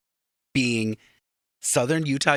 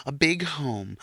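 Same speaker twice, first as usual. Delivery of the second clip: ordinary delivery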